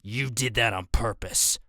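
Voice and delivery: lightly voiced brute accusing; menacing growl. A narrator's reading. The player accusing the narrator, a slow growling threat. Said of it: "the guy growled".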